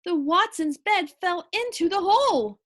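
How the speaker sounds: usual speaking voice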